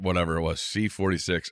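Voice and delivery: ordinary talk, nothing marked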